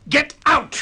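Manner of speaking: a high, loud command to leave; furious shout. Acted. Shipowner throwing the captain out, with a loud furious shout. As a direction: shout him out of the room.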